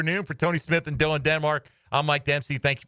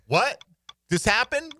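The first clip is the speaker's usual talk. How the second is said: surprised; 10 semitones higher, slower than usual. Retold or imitated. Reacting to a surprising documentary.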